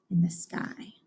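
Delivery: soft hushed aside; whisper. Real, not acted. Teacher talking about geese in the sky, whispered. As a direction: whisper it to the class. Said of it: "teacher aside".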